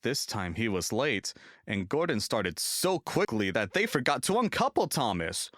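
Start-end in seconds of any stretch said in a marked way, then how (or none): none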